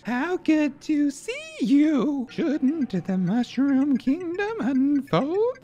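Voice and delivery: high, very slow eerie princess; slow, high, creepy royal voice; creepy Princess Peach. Acted. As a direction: draw out each word in an unsettling falsetto.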